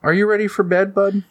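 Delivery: high-pitched mother voice; bedtime mom. Acted. Mom asking kid about bed, high coaxing voice. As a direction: coax a child, pitched well up.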